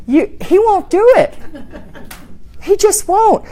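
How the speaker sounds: ordinary delivery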